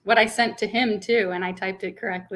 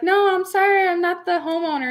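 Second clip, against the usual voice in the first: high, louder apologetic correction, imitated; appraiser; 10 semitones higher, 5 dB louder than usual. Appraiser corrects the old neighbor, high imitated apology. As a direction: correct her politely, pitched way up. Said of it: "And he's like".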